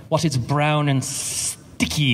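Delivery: very slow, drawling British impression; drawn-out, sneering joke delivery; Alan Rickman. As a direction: stretch words at random, pausing inside STICKY. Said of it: "Alan Rickman puts random emphasis on words".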